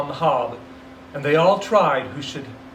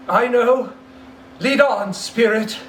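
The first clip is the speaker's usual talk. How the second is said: put-on raised, louder old man urging on; Scrooge, urgent; 8 semitones higher, 6 dB louder than usual. Scrooge telling the spirit to lead on, tense and loud. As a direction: Scrooge pushing himself forward, tense.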